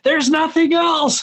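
hammed-up dramatic pronouncement. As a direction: overplay it for emphasis. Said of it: "being a little bit dramatic".

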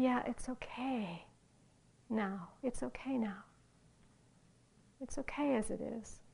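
soft, half-whispered reassurance; compassionate reassurance. Retold or imitated. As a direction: soothe gently, as a loving awareness would. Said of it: "how it is compassionate, how it is loving".

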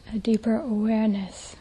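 ordinary talk, nothing marked

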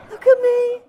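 very high, loud mock demand; attention-seeking child. Teacher mimicking the inner child for laughs, loud squeal for attention. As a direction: blurt it out like a needy kid.

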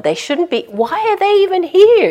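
high, loud exasperated complaint; agitated indignation. Retold or imitated. Meditation teacher retelling her furious thoughts, exasperated. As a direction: fume at the birds that don't belong.

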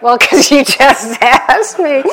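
loud, high reply broken by laughs; laughing aunt. Retold or imitated. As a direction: laugh through the obvious answer. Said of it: "And it's like".

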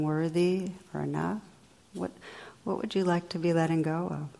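soft, gentle meditation guidance; quiet reflective questions with lingering pauses; guided reflection. Real, not acted. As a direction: ease listeners inward, leaving long silences.

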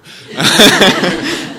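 loud, high laugh; real laugh bursting out at your own aside; big, helpless peal of laughter. Real, not acted.

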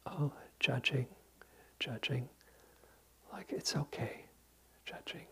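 tender, soft whisper; kindest voice. Shown as a demonstration. Teacher guiding students to whisper to their judging mind, tenderly. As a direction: whisper it kindly, like soothing a child. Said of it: "silently say in the kindest voice".

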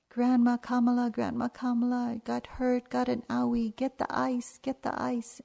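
put-on a hurt toddler begging for ice; crying toddler. Toddler calling to her grandma for ice, high and pleading. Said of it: "really crying".

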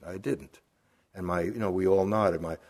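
ordinary delivery

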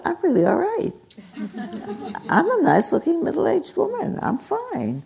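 high, amused self-acceptance; delighted realization. Retold or imitated. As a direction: retell a happy discovery about yourself. Retold happy realization, laughing.

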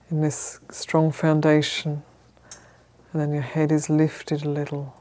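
calm, measured meditation guidance with long pauses; unhurried, even instructions, leaving space to settle; guided meditation. Real, not acted. Teacher settling a group into sitting posture, calm guided meditation.

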